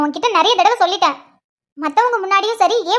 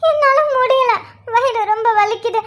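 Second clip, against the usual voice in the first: higher-pitched, tearful moaning; crying in pain; 3 semitones higher, slower than usual. Acted. Pregnant wife left waiting with worsening pains, crying in pain. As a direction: sob through the cramps.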